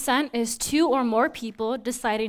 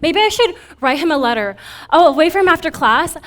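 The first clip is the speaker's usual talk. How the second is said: building from eager to frantic, much higher and louder; obsessive frenzy; 5 semitones higher, 8 dB louder than usual. Acted. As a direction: spiral into a giddy, obsessed rant.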